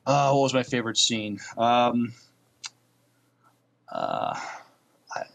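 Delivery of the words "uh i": usual speaking voice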